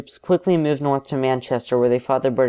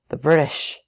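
panting; 3 semitones higher than usual. A narrator's reading. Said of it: "he says, panting".

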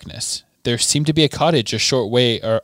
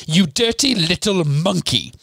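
higher, slow furious witch; Bellatrix bawling; 6 semitones higher than usual. Acted. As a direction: spit it at the elf. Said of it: "bawled Bellatrix".

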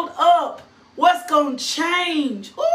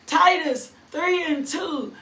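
ordinary delivery

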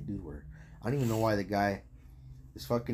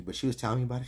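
ordinary talk, nothing marked

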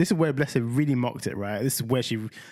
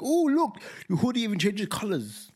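high gushing sing-song praise; mock gushing; 8 semitones higher than usual. Dad imitating himself gushing over the hoodie. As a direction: overdo the enthusiasm.

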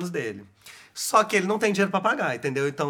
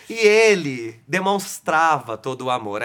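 ordinary talk, nothing marked

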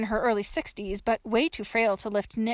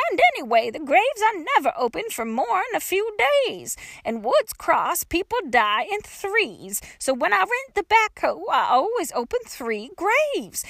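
put-on high, louder folksy old-lady voice; dotty old woman, justifying; 8 semitones higher, 4 dB louder than usual. A narrator's reading. Old woman explains open graves to trapped girl, high and stubborn.

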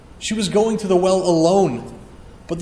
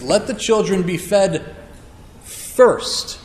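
"first" pulled out long, high and louder; stress on first; 3 semitones higher, 3 dB louder, slower than usual. Shown as a demonstration. Preacher quoting Jesus, leaning hard on "first". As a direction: land hard on the word "first". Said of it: "the particular words that he says".

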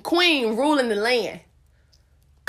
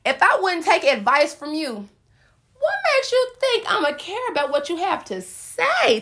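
mock preaching; 4 semitones higher than usual. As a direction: preach it up like a sermon. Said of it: "like I'm preaching this thing".

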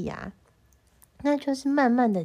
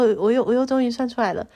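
normal talking voice

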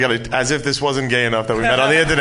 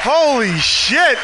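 surprised; 9 semitones higher, much slower than usual. Real, not acted. Surprised greeting on arrival.

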